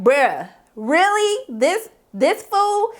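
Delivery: slow, drawn-out disbelief, climbing high; incredulous. Retold or imitated. Vlogger realizing who the boy is, incredulous. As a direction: stretch each word, unable to believe it.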